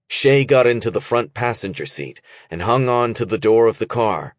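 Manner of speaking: ordinary delivery, nothing marked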